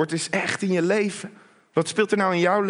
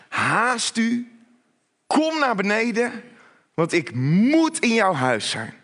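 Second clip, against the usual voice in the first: raised-pitch, pressing summons; urgent command; 5 semitones higher, slower than usual. Acted. Preacher voices Jesus summoning Zacchaeus down, urgent command. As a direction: call out with weight on "moet". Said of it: "Kom op, schiet op".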